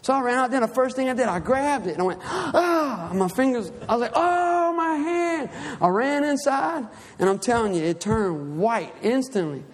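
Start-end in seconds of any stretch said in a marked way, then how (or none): 2.2-3.1 high-pitched yelp of pain; retold pain yelp. Preacher acting out grabbing the hot grill handle, pained yelp. 4.2-5.5 high-pitched wail, re-enacting the burn; acted pained wail. Preacher telling how he burned his hand, acted high wail.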